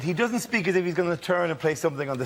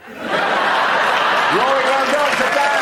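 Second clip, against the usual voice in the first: much higher, loud and slow through laughter; loud laughing praise; 10 semitones higher, 8 dB louder, much slower than usual. Real, not acted. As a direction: cheer him off the stage, laughing. Host congratulating, laughing.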